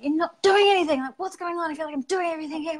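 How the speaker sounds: high, fast exasperated nagging; mock-rant at a lazy husband; nagging complaint. Retelling her nagging at husband.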